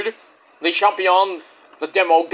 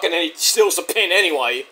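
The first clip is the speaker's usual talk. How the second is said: normal talking voice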